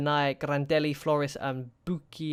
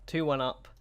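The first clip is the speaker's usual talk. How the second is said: ordinary talk, nothing marked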